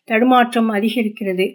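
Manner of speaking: usual speaking voice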